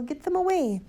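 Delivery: high-pitched outraged cry; screamed order. A narrator's reading. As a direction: cry out frantically, pitch right up. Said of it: "screamed Mister Hazel".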